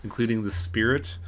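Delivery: ordinary talk, nothing marked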